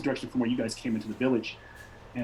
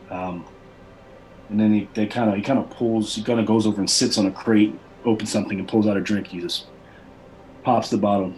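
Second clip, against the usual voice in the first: ordinary talk, nothing marked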